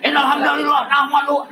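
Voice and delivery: high-pitched, forceful sermon opening; harsh opening. Shown as a demonstration. Preacher launching straight into the sermon, harsh and high. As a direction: open hard, pitched well up. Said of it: "Aya nu heuras we tuluy di awal".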